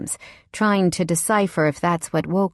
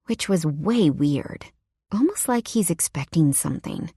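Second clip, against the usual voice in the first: ordinary delivery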